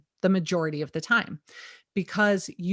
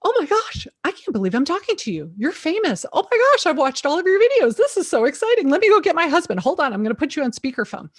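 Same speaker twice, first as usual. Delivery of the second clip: much higher and louder gushing squeal; thrilled fan; 8 semitones higher, 7 dB louder, faster than usual. Retold or imitated. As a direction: gush like a fan meeting a celebrity. Thrilled client meets YouTube agent.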